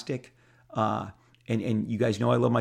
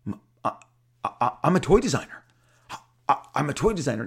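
fast, higher, louder exclamation; giddy announcement; 4 semitones higher, 4 dB louder, much faster than usual. Retold or imitated. Artist telling his studio mate, fast giddy exclamation. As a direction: blurt it out breathless with joy. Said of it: "I said".

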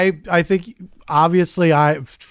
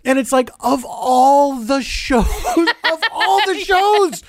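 laughing disbelief; 8 semitones higher, slower than usual. Retold or imitated. Incredulous laughing retelling.

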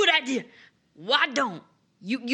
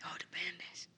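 whispering; 16 dB quieter than usual. Acted. Naming feared bandits.

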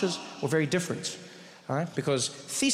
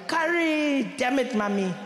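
mock shock; 7 semitones higher, slower than usual. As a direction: imitate the friend's shocked outburst, smaller. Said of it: "every day you're shocked and you're like".

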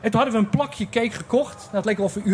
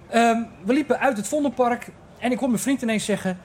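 ordinary talk

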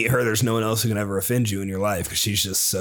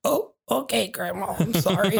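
put-on little kid voice; 8 semitones higher than usual. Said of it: "I'm like".

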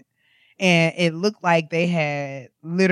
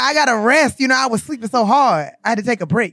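imitating Dida; 5 semitones higher, 5 dB louder than usual. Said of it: "He be like".

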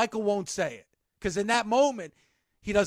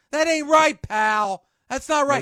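louder, half-joking protest; mock indignant; 6 semitones higher, 6 dB louder than usual. Real, not acted. Protesting to Cody, a loud mock-indignant complaint. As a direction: complain loudly but with a grin. Indignant at Cody's jinx jab.

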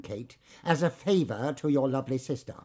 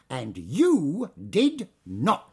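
slow, pointed, word by word; stern slow put-down; slow pointed scolding; much slower than usual. Acted. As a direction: drag it out to shame her. Manager scolding late girl.

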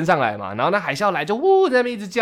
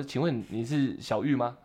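much softer and slower, tentative; timid fan question; 6 semitones lower, 10 dB quieter, slower than usual. Retold or imitated. Fan in the slide queue shyly asks her name, softly. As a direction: ask it shyly, barely aloud.

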